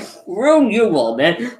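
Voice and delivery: high, slow acted brush-off; mock defiance. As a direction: imitate an exasperated dismissal. Retold defiant outburst in quarrel.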